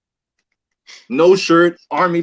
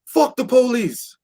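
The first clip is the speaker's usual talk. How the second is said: mock rapper hype shout; 10 semitones higher than usual. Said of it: "grabs the mic and says".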